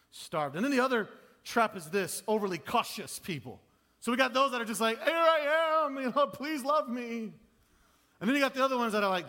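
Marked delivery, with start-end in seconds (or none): 5.0-7.3 high, loud, begging mimicry; mock needy pleading. Pastor imitates needy people craving love, high and loud. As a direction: beg for love in a hammed-up way.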